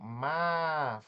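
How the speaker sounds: MÁ stretched out by itself; syllable held long. Shown as a demonstration. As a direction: hold the vowel of Má. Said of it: "levou mais lentidão pra pronunciar". ("Má" bem prolongado)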